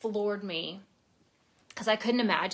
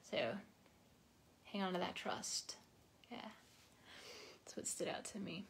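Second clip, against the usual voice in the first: whisper; 2 semitones lower, 16 dB quieter than usual. Real, not acted. Aside after reading.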